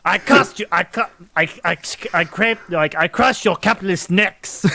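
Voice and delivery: jokey Russian wrestler threat; halting mock Russian accent. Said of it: "he does, like, a Russian accent".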